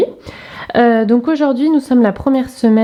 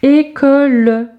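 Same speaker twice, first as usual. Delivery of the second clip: slow, higher "École"; segmented word for young readers; syllable by syllable; 4 semitones higher, slower than usual. Shown as a demonstration. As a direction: stretch "École" into held syllables.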